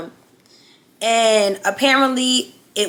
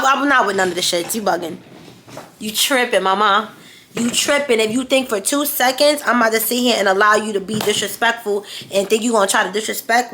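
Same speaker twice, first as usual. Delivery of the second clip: usual speaking voice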